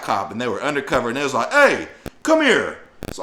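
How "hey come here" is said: slow, high, loud command; cop calling out. Retold or imitated. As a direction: stop him in his tracks. Undercover cops call him over.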